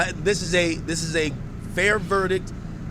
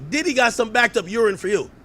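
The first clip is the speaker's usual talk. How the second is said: ordinary talk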